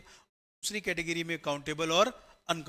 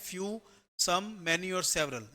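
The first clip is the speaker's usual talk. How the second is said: slow listing; much slower than usual. Shown as a demonstration. Listing the four words slowly.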